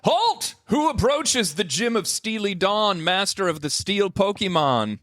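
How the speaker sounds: high shouted challenge; booming gym leader. Acted. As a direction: call out like a proud gym master.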